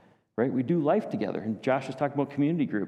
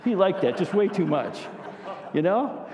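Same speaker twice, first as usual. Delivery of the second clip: ordinary talk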